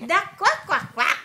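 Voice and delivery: high, loud playful quacks; excited quacking. Shown as a demonstration. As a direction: burst out quacking for the child. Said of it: "corrects toddler's duck sound".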